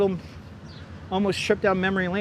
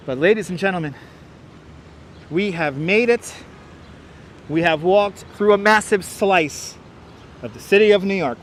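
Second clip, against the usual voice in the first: grand announcement. Real, not acted. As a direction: announce the triumph like a ringmaster.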